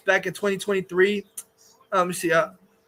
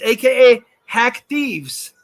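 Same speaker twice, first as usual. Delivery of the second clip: "A K A Hack Thieves" called out louder and drawn out slowly; loud slow mocking nickname; 4 dB louder than usual. Real, not acted. Streamer mocking Matt Reeves by his nickname, loud slow jeer. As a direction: hammer the nickname home with slow loud scorn.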